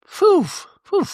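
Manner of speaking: high, puffed "phew"; two exaggerated blown-out sighs of relief; mock relief. Imitated relief after apology.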